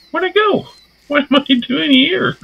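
very high and loud cartoon voice; goofy high yelp. Player lost in the woods, very high loud goofy yelps. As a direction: squeal it like a confused cartoon.

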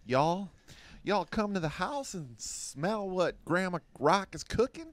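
high, slow hammed-up Southern drawl; Southern Grandma Rock calling folks to the house; Southern accent Rock. Host hams up The Rock in a Southern accent. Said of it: "With a Southern accent".

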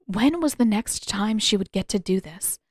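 ordinary delivery

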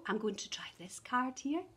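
fast soft whisper. Acted. Teacher whispers a guess to the children. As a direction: confide it to the kids. Hushed aside.

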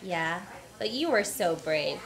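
ordinary talk

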